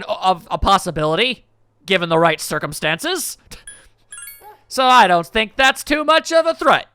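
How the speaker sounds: ordinary delivery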